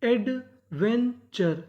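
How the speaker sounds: "Adventure" very slowly, in separated chunks; syllable-by-syllable reading. Shown as a demonstration. Teacher assembling the word for students, slow separated parts of "adventure". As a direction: break "adventure" into ed, ven, char with gaps. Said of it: "तो meaning क्या बनी".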